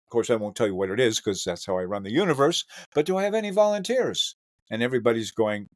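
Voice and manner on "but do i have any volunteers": teacher asking volunteers. Retold or imitated.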